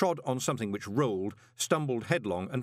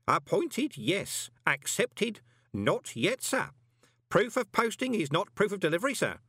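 put-on high, pedantic old postman; officious old postman; 11 semitones higher than usual. A narrator's reading. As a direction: correct the boss respectfully, pitched high. Groat corrects Moist.

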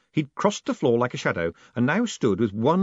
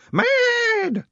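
one long, high, loud wail of the word; relishing the syllable; 6 dB louder than usual. A narrator's reading. Woman savouring the curse's effect, relishing it. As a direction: hold on to it, loath to let go. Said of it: "she seemed loath to let it go".

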